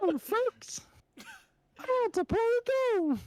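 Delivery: very high squeaky puppet voice; Elmo voice. Shown as a demonstration. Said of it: "I'm trying to get it to come out of my mouth".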